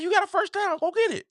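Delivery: high, urging call to go get it; yelling at the screen. Retold or imitated. Fan yelling at the quarterback to get the first down. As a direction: urge him on like a fan shouting at the TV. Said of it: "And I was like".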